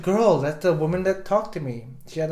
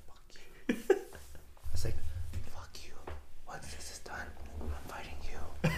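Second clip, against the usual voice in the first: soft whispered curse; hushed curse; 4 semitones lower, 10 dB quieter than usual. Retold or imitated. To friend at the Starbucks pitch, whispered fury. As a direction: whisper it so the room can't hear.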